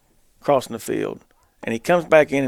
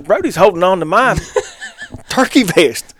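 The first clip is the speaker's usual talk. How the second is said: raised, loud telling through laughter; laughing; 6 semitones higher, 6 dB louder than usual. Real, not acted. Telling friends how Brody clung to him, laughing hard. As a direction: break up laughing as you tell it.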